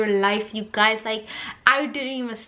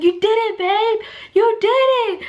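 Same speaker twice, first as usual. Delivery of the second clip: loud, much higher, triumphant shout; cheering husband; 10 semitones higher, 4 dB louder than usual. Retold or imitated. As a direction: cheer her on like she just won. Husband cheering after the birth.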